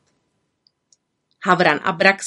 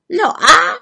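high, loud, offhand squawk; squawking raven; 11 semitones higher, 8 dB louder than usual. A narrator's reading. Raven prompting the upset witch, curt squawk. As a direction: squawk it as a challenge.

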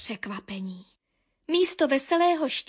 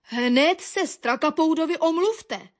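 firm, raised-pitch order; commanding; 4 semitones higher, 4 dB louder than usual. A narrator's reading. Baron the dog orders birds to apologise, commanding. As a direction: order them to do it at once. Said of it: "přikázal".

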